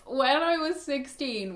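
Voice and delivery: usual speaking voice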